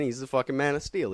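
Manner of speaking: ordinary delivery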